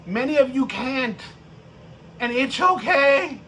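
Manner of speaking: usual speaking voice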